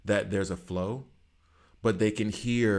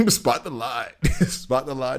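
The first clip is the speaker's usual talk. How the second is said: laughing through it; 5 semitones higher, 6 dB louder than usual. Real, not acted. Laughing while repeating the phrase.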